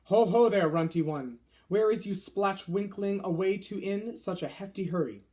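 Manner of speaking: loud, mocking giant voice; bullying giant. A narrator's reading. Fleshlumpeater mocking the runty BFG, loud and sneering. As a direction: bellow a sneering taunt.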